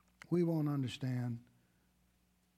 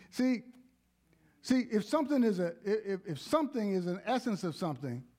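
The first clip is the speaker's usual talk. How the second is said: preaching at full force; 5 semitones higher than usual. Acted. Preaching builds.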